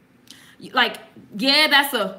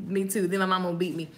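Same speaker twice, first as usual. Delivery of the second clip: ordinary delivery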